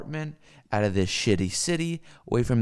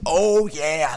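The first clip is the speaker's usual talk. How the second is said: usual speaking voice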